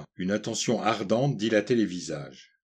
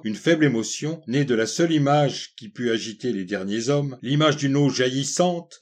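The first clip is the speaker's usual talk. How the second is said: plain reading voice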